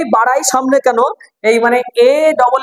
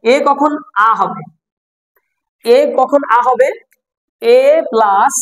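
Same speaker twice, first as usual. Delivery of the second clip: slow quiz question; slower than usual. Shown as a demonstration. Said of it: "teacher poses question slowly".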